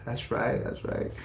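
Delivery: a quiet smug smile in the voice. Retold or imitated. Boy beside his dad before the teacher, softly smiling. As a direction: murmur it softly with a grin. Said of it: "and I'm smiling".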